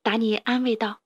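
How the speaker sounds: usual speaking voice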